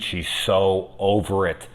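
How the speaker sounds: ordinary talk, nothing marked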